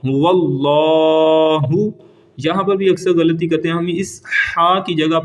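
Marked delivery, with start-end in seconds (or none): 0.0-1.7 هو اللہ with a heavy lam held long in اللہ; هو اللہ, thick lam drawn out. Shown as a demonstration. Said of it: "اس کو لمبا کریں گے".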